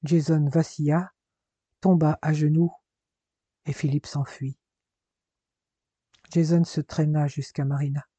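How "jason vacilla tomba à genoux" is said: usual speaking voice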